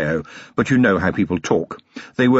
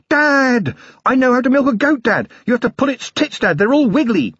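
put-on high, quick, breathless small child; a tiny boy bursting to tell his dad everything; excited little boy; 14 semitones higher, 3 dB louder than usual. Small son running to his father, bursting with excitement.

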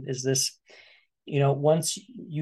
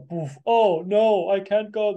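fretting water; 3 semitones higher, 4 dB louder than usual. Acted. Water voiced as fretting figure.

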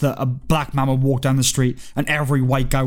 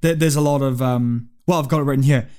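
ordinary delivery

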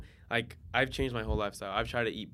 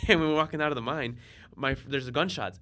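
usual speaking voice

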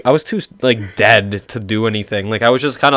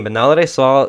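ordinary talk

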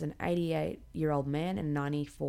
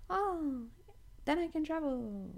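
much higher, silly mock voice of a careless traveller; mocking airhead voice; 11 semitones higher than usual. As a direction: sneer at them in a high, ditzy voice. Mocking people who want the jab.

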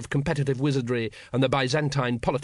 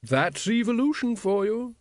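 happily; 10 semitones higher, slower than usual. A narrator's reading. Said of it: "said the wizard happily".

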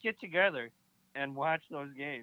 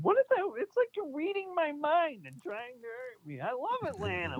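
high indignant disbelief; mock outrage. Friend pretending to be hurt by an Atlanta diss, high. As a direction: squeal like you've been betrayed.

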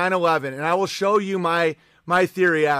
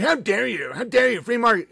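higher, whiny mock outrage; mocking boomers; 4 semitones higher than usual. Streamer mocking boomers who cry free market. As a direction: sneer it like a scandalized boomer. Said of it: "They're like".

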